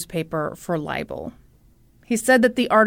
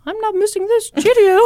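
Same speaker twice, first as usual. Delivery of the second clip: mock British lady; 11 semitones higher, 4 dB louder than usual. Judge's wife gushing about [name], in a mock British accent. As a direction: play a British lady, high-pitched and hammed-up.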